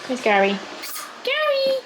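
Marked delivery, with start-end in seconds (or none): 1.2-1.8 high call for the dog. Real, not acted. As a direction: call the dog across the room. Calling dog.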